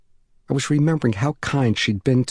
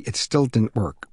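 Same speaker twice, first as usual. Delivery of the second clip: plain reading voice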